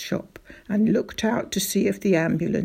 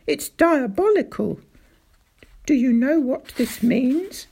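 loud, raised, outraged; indignant rant; 4 semitones higher, 5 dB louder than usual. Acted. Doctor rants to father about diabolical traps, indignant. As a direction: keep the fury going.

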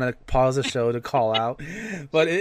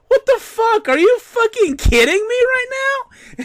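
much higher and louder, furious disbelief; outraged disbelief; 6 dB louder than usual. Retold or imitated. Recalling the girls who FaceTimed during the movie, outraged shout. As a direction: let the anger burst out at the rude teens. His outraged reaction in theater.

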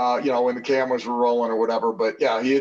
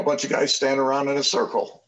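usual speaking voice

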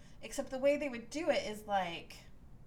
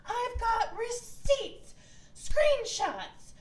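very high, slow, hammed-up yell; imitating Heather yelling at Monica at dinner; mock yelling; 14 semitones higher, 11 dB louder, much slower than usual. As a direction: shout out the evidence like a diva. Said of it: "she starts yelling".

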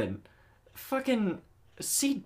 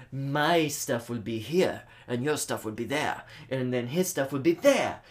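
bad Russian accent; 2 semitones higher, 3 dB louder than usual. Mocking the Russian guy's map speech, bad Russian accent. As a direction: do a hammy Russian villain dividing up land. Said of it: "doing a bad Russian accent".

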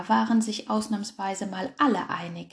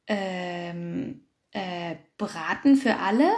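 reverent; 3 semitones lower, slower than usual. A narrator's reading. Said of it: "fragte der Flunkerfuchs andächtig".

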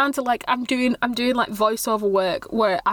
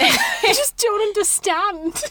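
high, drawn-out giggly confusion; helplessly confused through laughter; laughing bewilderment; 8 semitones higher, slower than usual. Real, not acted. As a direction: stretch it out slowly, laughing.

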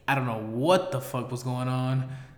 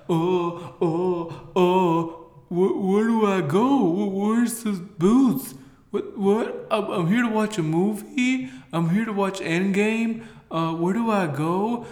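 higher, panicky mock voice of a lost moviegoer; confused lost guy; 5 semitones higher than usual. Imitating a lost moviegoer at the Halloween event, higher and flustered. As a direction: play a clueless guy who can't find the booths.

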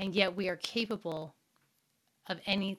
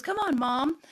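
higher, louder kid's call, imitated; 7 semitones higher, 6 dB louder than usual. Son atop the boulder urging his mom on, calling out.

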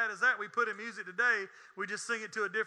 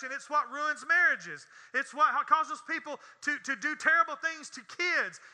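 raised, hammering list that builds; full-force preaching; 5 semitones higher, 4 dB louder than usual. Real, not acted. Preacher tells church what the mantra ruins, full-force preaching. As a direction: pile up the charges, climbing to the end.

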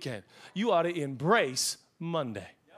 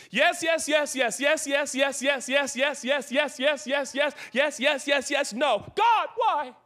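rapid-fire string of yeses breaking into a high wail; mock complaint; 8 semitones higher, 4 dB louder, slower than usual. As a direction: rattle off approvals, then protest loudly to God. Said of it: "it works something like this".

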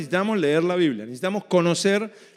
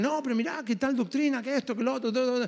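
quick, higher, softer chattering objector; mock confusing arguer; 5 semitones higher, 6 dB quieter, faster than usual. Attacker pestering a believer with twisted verses, mock chatter. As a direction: lightly mimic a rambling challenger, higher and hushed. Mimics someone arguing to confuse.

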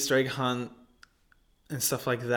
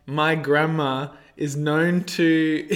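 breaking up into laughter mid-sentence; can't get through it for laughing; much slower than usual. Real, not acted. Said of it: "sorry, let me compose myself".

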